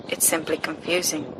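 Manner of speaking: ordinary delivery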